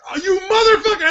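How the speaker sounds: high, shouted mock fury; hammed-up indignant yell; mock outrage. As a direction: cry out as if cruelly betrayed. Retold outrage at the director.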